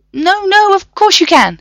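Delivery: fluttering nervous academic; flustered professor. A narrator's reading. Professor reassuring the trapped men, flustered and high.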